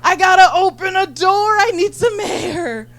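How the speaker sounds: high, loud, slow dramatic wail; girlfriend overwhelmed by debate. Retold or imitated. Mimicking her girlfriend needing air during the debate, high and wailing. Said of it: "she's just like".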